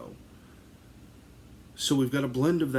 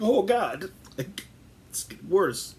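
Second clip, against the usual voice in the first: mock despair; 10 semitones higher, 5 dB louder than usual. Inner despair retold.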